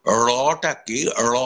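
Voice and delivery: slow রটাকে with the tongue touching above; tongue-tip র. Shown as a demonstration. Teacher showing the tongue position for র, slow. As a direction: say র in রটাকে slowly, tongue up behind the teeth. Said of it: "কারণ আমার জিভটা উপরে লাগছে".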